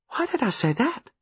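put-on troubled, puzzled young woman; bewildered. A narrator's reading. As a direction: wonder at your own words. Baffled by her own answer.

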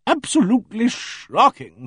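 loud, high and slow, a hearty boom. Acted. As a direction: boom it out like a bossy country lady. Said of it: "she boomed".

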